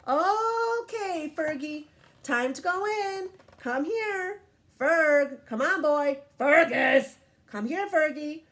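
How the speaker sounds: coaxing owner calls out; calling the dog. Acted. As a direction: call a dog in from the yard.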